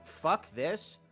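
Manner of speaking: ordinary delivery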